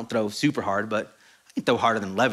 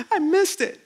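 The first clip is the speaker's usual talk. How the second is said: high mock sobbing with gasps; gasp, then wail it like a hurt kid; crying child imitation. Dad retelling his son's tears after the ball hit, mock crying. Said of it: "he's crying, and he goes".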